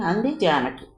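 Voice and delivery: ordinary delivery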